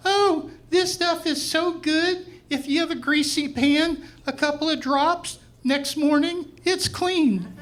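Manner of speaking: high, slow gushing commercial pitch. Retold or imitated. Cashier holding soap like an ad, high gushing commercial voice. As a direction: sell the soap like a TV ad, high and sweet. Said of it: "like in a commercial".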